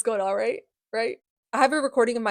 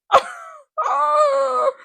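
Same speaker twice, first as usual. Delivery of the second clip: high, loud shriek of horrified laughter; scream-laugh in disgust; 8 dB louder than usual. Real, not acted. Reader appalled by a creepy line, high screaming laugh.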